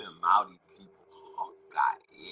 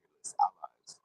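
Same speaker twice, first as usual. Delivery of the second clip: a sudden conspiratorial whisper; 2 semitones lower, faster than usual. Real, not acted. Dropping to a whisper while naming America's allies. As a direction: breathe the words out in a whisper. Whispered aside mid-rant.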